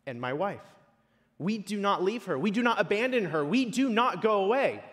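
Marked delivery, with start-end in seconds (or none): 1.4-4.8 higher, emphatic sermon voice; firm, impassioned declaration from the pulpit; insistent preaching. Acted. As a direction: hammer out each repeated denial. Preacher insists in repeated phrases.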